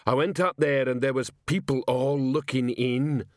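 thick, put-on lumbering voice; stoical Detritus. A narrator's reading. Detritus reports to Vimes on the maid, stoically. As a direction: report stolidly in a dull-witted constable's voice.

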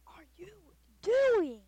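high, loud, slow mock angry yell; teacher cursing. Imitating the teacher snapping at me in class, mock yell. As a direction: blast it like a furious teacher. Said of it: "curse at me saying".

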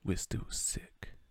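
breathy, slow whispered boast. Real, not acted. Whispering a last boast to his viewers. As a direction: let it out as a secret.

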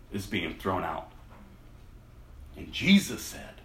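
Bible passage read aloud with feeling; storyteller reading scripture; dramatic scripture reading. A narrator's reading. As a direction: tell the trial of Jesus to the congregation. Preacher reads the Gospel passage.

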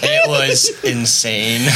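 high, cackling laugh through the word; witch laugh. Real, not acted. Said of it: "Sorry for my witch laugh".

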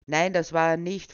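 curt angry retort; snapping. Acted. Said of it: "fuhr ihn der Bürgermeister schon beinahe an".

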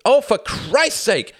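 loud, high, drawn-out exasperation; snapped. A narrator's reading. Tom cutting off his friend's objection, snapping. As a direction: explode in furious impatience. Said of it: "snapped Tom".

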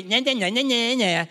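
put-on high sing-song child taunt; nah-nah child taunt, high; taunting kid. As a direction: tease your brother. Grandkid taunting sibling.

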